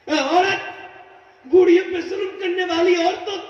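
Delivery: high, shouted sermon warning; fiery preaching. Acted. Preacher warns cruel women they too will age, fiery.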